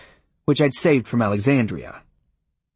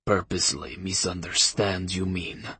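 put-on quiet contemptuous Russian accent; spat; 3 semitones lower, 6 dB quieter than usual. A narrator's reading. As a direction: spit the words with scorn. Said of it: "the rabbi spat".

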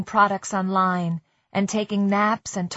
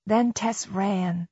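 usual speaking voice

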